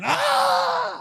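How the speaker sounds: high, loud joking war cry; mock battle yell. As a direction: cut loose with a cartoon yell. Draymond charging with machete.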